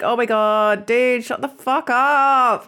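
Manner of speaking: drawn-out, high mock exasperation; exasperated at a mate. Telling a joking mate to stop, playful exasperation. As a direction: groan it out playfully, stretching the last words. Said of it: "maybe just be like".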